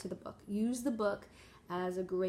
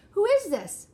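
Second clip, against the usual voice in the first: higher, louder stern demand; 11 semitones higher, 11 dB louder than usual. Acted. Elephant demanding who owns the egg, stern demand. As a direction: demand an answer like a cross elephant. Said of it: "he demands".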